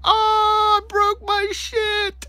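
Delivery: very high, loud, drawn-out shriek; little-girl scream. Retold or imitated. Reenacting his fall in the dark, screamed like a little girl. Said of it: "screaming like a little girl".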